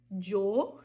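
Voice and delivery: YO said with a voiced "dj/zh" sound, "djo"; Y variant. Shown as a demonstration. Teacher listing variants, "djo" for yo. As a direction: harden the Y of yo into "djo". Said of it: "eu posso falar".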